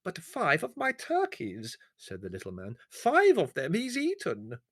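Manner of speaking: high, slow, wheedling complaint; whining villager. Acted. The servile peasant complains to Leslie: high wheedling whine. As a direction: plead your loss with fawning humility.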